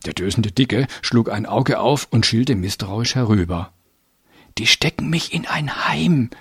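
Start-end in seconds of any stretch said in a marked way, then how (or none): 4.6-6.3 put-on high whisper of a small boy. A narrator's reading. Little boy confiding his fear of a children's home, whispered. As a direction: whisper it like a frightened secret. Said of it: "flüsterte der Kleine".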